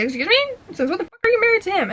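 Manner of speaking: incredulous, high and rushed on the second question; mock outrage. Reader recalling the shock of learning they married, mock outrage. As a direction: act out your disbelief at a plot twist. Retelling own shocked reaction.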